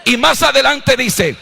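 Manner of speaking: shouting the scripture from the pulpit; full-force preaching. Acted. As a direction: thunder the verse at the congregation.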